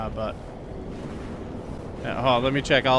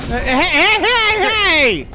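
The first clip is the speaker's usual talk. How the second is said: calling out; 11 semitones higher, 4 dB louder, much slower than usual. Real, not acted. Calling to the zombie.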